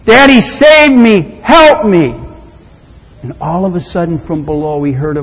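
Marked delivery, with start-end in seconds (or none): 0.0-2.1 high, loud plea for rescue; desperate call. Acted. Trapped little boy calling to his daddy, desperate shout. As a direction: call out for help, drawing it out.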